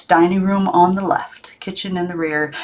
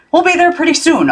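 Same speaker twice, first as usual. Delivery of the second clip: higher, louder uncle voice; Uncle Morris voiced; 11 semitones higher, 4 dB louder than usual. A narrator's reading. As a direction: give the uncle a brighter lift.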